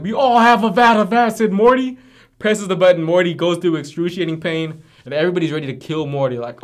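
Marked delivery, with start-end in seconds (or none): 0.0-2.0 high, loud mock Rick, voice stretched up; hammed-up mad scientist line, pitched way up and pushed loud; Rick quote. Retelling the episode to his friend, loud high Rick impression.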